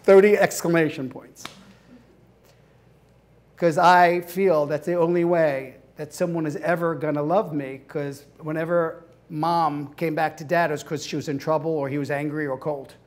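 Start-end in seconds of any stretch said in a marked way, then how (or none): none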